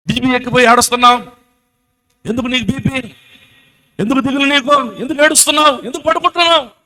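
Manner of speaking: high-pitched, insistent rebuking questions; acted scolding. God scolding frightened Elijah, acted.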